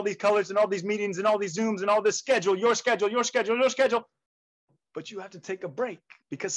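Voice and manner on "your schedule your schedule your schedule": rapid-fire repetition; higher, hurried pile-up of words; piling up. Acted. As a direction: rattle it off faster and faster. Piles up an overloaded calendar.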